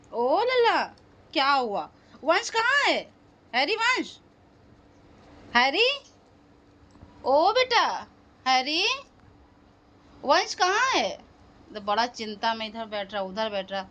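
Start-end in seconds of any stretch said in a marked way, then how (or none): 0.1-11.1 lilting high calls to the pet; high calling. Real, not acted. Asking the dog for the boy.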